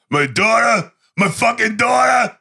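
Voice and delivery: high, straining outraged cry; enraged father. Retold or imitated. Father stomping the man who hurt his daughter, enraged. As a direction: roar it as a vengeful father. Sly raging while stomping Nico.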